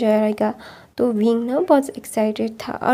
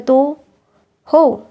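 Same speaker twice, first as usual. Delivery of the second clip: defiant outburst; 3 semitones higher, 6 dB louder, much slower than usual. Retold or imitated. Wing talks back defiantly.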